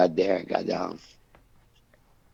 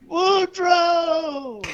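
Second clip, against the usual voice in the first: long, high, louder call of the name "Boudreaux"; hollering across the stadium; 3 dB louder than usual. Retold or imitated. Thibodeau calls across the stadium, drawn-out high holler. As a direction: stretch out "Boudreaux" like yelling over a crowd. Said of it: "Thibodeau started hollering".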